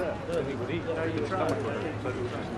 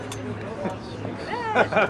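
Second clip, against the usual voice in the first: bright burst of laughter; real laugh at the story; 7 semitones higher, 3 dB louder than usual. Real, not acted. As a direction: laugh it off, high.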